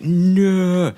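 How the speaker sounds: a loud, high drawn-out cry; slow-mo no. Acted. Partner shot in the head, hammed-up loud cry. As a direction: scream it in slow motion.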